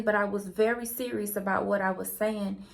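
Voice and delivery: ordinary delivery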